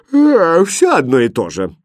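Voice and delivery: high, loud yawn into the words. A narrator's reading. Bored princess after the prince's flattery, yawning. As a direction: talk through a bored yawn. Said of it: "и зевнула".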